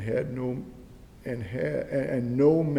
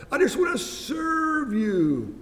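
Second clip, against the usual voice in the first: much higher and louder, slow and pleading; high pleading son; 11 semitones higher, 5 dB louder than usual. Retold or imitated. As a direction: cry it up high to Dad, slow and earnest. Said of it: "his attitude is".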